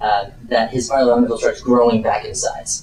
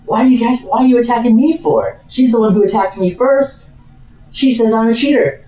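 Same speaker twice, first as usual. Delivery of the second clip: high-pitched, aggrieved protest; Ivan; 9 semitones higher, 3 dB louder than usual. Acted. Knocked-down duellist protesting to those who tackled him, high aggrieved voice. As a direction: push back with wounded indignation.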